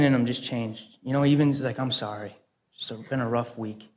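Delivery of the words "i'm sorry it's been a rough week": imitating a humbled man, low and very soft; quiet, deflated admission; subdued apology. Shop owner apologising after his demeanor changed, subdued.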